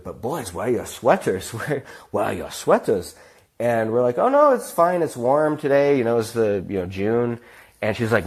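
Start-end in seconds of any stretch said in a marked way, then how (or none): 0.0-3.2 put-on fussing German landlady with sing-song accent; lilting mock German accent, peaks on key words; German-accented scolding. German landlady nagging two boys about sweaters, accented fussing. 4.2-7.4 breezy protest. Retold or imitated. Two boys assuring their host it's warm, breezy protest. Boys brush off her sweater demand.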